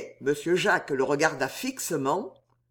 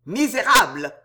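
loud, higher-pitched furious insult; roared anger; 4 semitones higher, 6 dB louder than usual. A narrator's reading. Count insulting his mocking opponent, roared anger. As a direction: roar it in narrated outrage. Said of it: "rugit le comte".

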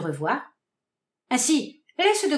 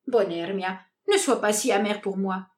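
plain reading voice

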